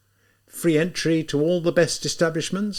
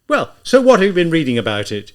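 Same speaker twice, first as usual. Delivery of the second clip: bewildered, impatient shout, louder; sudden shout; 4 semitones higher, 7 dB louder than usual. A narrator's reading. Zamyotov snaps at Raskolnikov, impatient bewildered shout. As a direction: burst out, losing patience. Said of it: "he shouted suddenly, in bewilderment and impatience".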